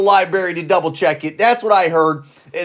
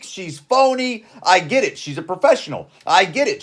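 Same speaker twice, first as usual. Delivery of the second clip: ordinary talk, nothing marked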